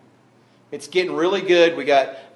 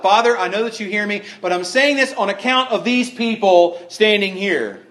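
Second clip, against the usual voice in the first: slightly raised, higher praying voice; praying out loud; 6 semitones higher, 4 dB louder than usual. Acted. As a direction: pray it aloud so the crowd hears. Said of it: "He's saying this out loud".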